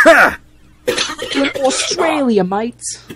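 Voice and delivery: lightly hammed-up Australian; mock Australian accent. Player announcing the Australia track, mock Australian accent. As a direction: put on a jokey Aussie accent, starting high. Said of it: "I hope I'm not offending any Aussies".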